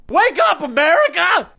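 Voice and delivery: high-pitched catchphrase yell; rallying cry. Acted. Host opening a rant segment at America, rallying cry. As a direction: holler it to rouse a nation.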